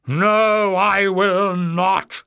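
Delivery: high, loud, furious shriek; enraged shriek. A narrator's reading. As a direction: shriek it out in a frenzy of rage. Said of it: "Warren shrieked in a frenzy of rage".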